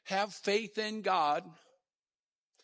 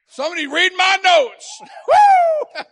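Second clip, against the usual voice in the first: high, loud, laughing whoop; laughing shout; 11 semitones higher, 16 dB louder than usual. Real, not acted. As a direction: burst out in joy.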